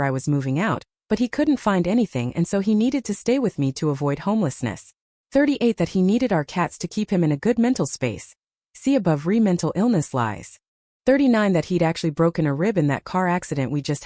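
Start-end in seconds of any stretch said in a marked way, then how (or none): none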